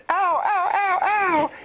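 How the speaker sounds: very high pained squeaky "ow, ow"; pained squeals. Acted. Little pufferfish squealing in pain at the horse. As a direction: squeal in pain like a tiny fish.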